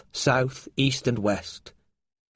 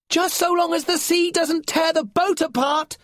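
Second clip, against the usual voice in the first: high, loud yelled retort; yelling; 4 dB louder than usual. Acted. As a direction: yell back over the storm. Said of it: "yelled Lorca".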